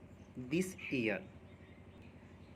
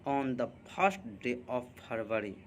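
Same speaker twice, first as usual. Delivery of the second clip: very slow, every word laid out for students; slow dictation; 2 semitones lower, much slower than usual. Shown as a demonstration. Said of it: "पहली फरवरी को".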